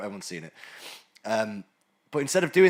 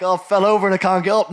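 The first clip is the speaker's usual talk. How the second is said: louder, higher mock whine; mock helpless voice; 6 semitones higher, 10 dB louder than usual. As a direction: ham up the helpless complaint. Voicing an obese person mockingly.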